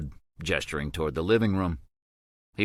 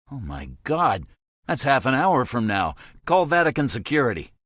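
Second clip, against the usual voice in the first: higher, louder, rising panic; urgent alarm; 7 semitones higher, 5 dB louder than usual. A narrator's reading. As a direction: shout the order in a rush of fear. Kevin panics at the deadline.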